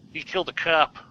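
hammed-up Chicago movie-cop voice; Chicago accent. Host retrying a Fugitive line, Chicago accent. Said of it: "that guy from The Fugitive".

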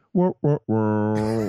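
slow, drawn-out wah-wah-wah; mimicked wah noise. Co-host imitating a sound for laughs, drawn-out mimicked wah. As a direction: stretch each wah out long.

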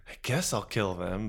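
quiet, offhand menace; deadpan quiet. Retold or imitated. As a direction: say it softly and casually. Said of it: "you're just like".

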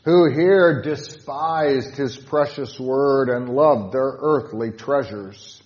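slow, loud solemn reading; slow loud hymn recitation; hymn line read aloud. Shown as a demonstration. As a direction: declaim each word of the verse. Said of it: "Stanza four".

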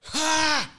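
a high, breathy mock laugh; silent laugh burst. Silent laugh mimed for the hosts, a high breathy "Ha!". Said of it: "like he's about to go".

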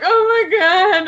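high, drawn-out tearful wail; mock bawling. Fan re-enacting her reaction to the reunion, mock bawling.

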